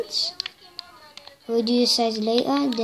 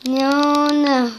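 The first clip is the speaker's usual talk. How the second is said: loud sharp no; 4 semitones higher, 7 dB louder than usual. Real, not acted. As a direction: snap the refusal out loud. Sister interrupts, annoyed refusal.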